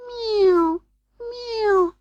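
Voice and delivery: very high, plaintive meow; cat's crying mew. A narrator's reading. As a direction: cry out like a cat. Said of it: "cried Simpkin".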